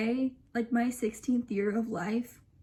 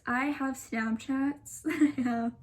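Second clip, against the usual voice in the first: ordinary talk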